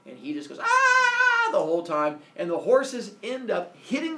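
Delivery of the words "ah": long, loud, high "aah"; hammed-up falling scream; mock terrified scream. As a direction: scream as if plummeting to earth. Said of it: "and just screams".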